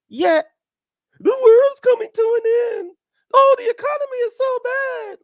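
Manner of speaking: high, whiny voice of a doomsayer; mock the complainers in a high, wailing voice; high, whining doomsday complaint; mock doomer whine. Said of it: "I'm like, real estate is high".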